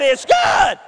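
loud call, pitch rising at the end; referee calling it. Retold or imitated. Referee repeating the call to the stunned player, loud.

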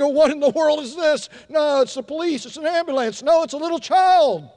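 hammed-up alarmed guessing; mock alarm. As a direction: play the panicked parent woken by a siren. Startled parent mistakes cry for siren.

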